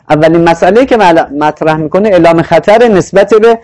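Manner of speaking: ordinary talk, nothing marked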